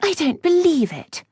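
high, firm contradiction; stout disbelief. A narrator's reading. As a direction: object stoutly, pitched well up. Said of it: "Octavia said stoutly".

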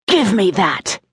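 understated angry demand; restrained growl of annoyance; mild snarl. A narrator's reading. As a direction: demand it back with a touch of snarl. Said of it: "Vander snarled".

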